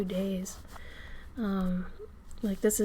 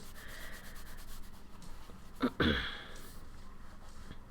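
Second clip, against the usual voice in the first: throat clearing; 12 dB quieter than usual. Real, not acted. Loud throat clear, mic.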